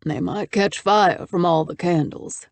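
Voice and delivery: put-on slow, gravelly older man. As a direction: drawl it like an old rancher. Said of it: "he said in a gravelly voice".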